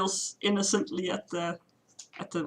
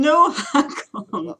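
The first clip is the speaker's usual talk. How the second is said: alarmed plea; 7 semitones higher, 9 dB louder, much slower than usual. Acted. Anna urgently stops Hakon.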